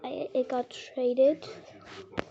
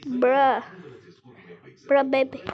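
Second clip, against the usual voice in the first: exasperated bruh; 5 dB louder than usual. Real, not acted. Calling out to the in-game baby.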